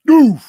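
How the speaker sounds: loud, high mock crash noise; a comic boom for the impact; imitated impact thud. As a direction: blurt out the sound of the hit. Describing the crash that broke bones.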